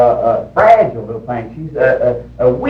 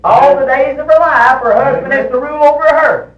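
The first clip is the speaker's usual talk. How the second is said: high, loud pulpit delivery; ringing, emphatic preacher's declaration; forceful preaching; 10 semitones higher, 6 dB louder than usual. Real, not acted. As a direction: proclaim scripture at full voice. Preacher drives home scripture point.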